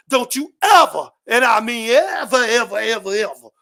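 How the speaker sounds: ever を何度も強く繰り返し、最後の ever は低く落として; insistent repeated ever. Real, not acted. Urging men on the panel.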